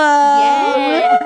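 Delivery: loud, high squeal of joy; excited cheer. Real, not acted. Audience cheers at free raffle.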